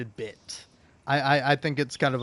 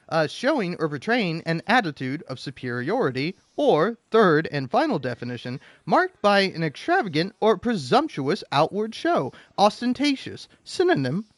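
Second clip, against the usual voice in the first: ordinary talk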